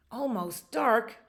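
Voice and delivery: a hint of a puzzled bear; surprised bear. A narrator's reading. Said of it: "the bear said".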